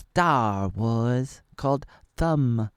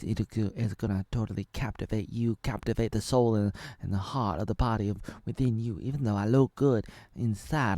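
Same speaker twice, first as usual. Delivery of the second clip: usual speaking voice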